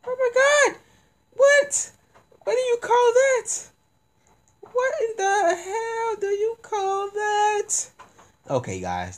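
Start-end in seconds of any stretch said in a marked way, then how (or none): 0.1-7.9 high falsetto shriek, slowing at end; shrieking outrage. Real, not acted. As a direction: screech it out high and loud. Outraged at game result.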